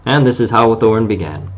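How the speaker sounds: ordinary delivery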